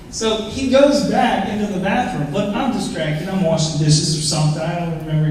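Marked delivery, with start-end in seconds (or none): none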